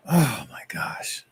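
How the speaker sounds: breathless hushed oh my gosh, slow; shocked whisper. Real, not acted. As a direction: whisper it in dismay, drawn out. Realizes she said banned word.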